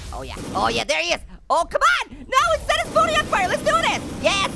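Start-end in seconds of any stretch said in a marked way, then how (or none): 1.5-4.5 high-pitched battle cry; rally yourself high and urgent; frantic urging. Real, not acted. Player battles to set Shrek alight, frantic urging. Urges himself on attacking Shrek.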